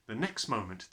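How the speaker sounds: plain reading voice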